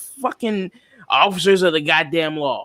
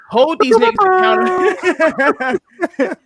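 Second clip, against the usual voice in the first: slow, high, loud drawn-out plea; emphatic shout; 5 dB louder, slower than usual. Real, not acted. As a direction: stretch each word out and belt it. Impassioned plea to hold cops accountable.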